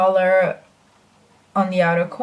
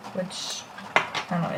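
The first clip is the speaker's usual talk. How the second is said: soft unvoiced whisper to herself; whispered aside; 2 semitones lower, 9 dB quieter than usual. Real, not acted. To viewers, dismissing the lipstick number in a whisper. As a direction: breathe it as a quick aside.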